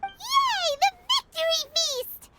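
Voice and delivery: high, bright squeal of joy; excited cheer. Real, not acted. Paimon cheering about a victory feast, squealing with joy.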